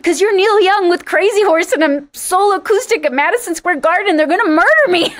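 high, loud exclaimed mock panic; retold panic. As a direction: blurt it out loud and high, hamming up the dread. Acted panic answer to [name].

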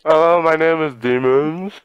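talking through a mouthful; slow words round a bite of food; mouth full. Real, not acted. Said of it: "I got food in my mouth right now".

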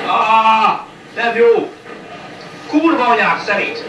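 high, loud frustrated yell; furious outburst. Real, not acted. Gamer cursing at the screen, furious yell. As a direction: explode at the game. Gamer loses a fight in-game.

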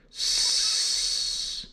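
a quiet snake hiss. Shown as a demonstration. Teacher spelling snake for children, soft hiss. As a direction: let the s hiss out softly. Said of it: "Simon the snake".

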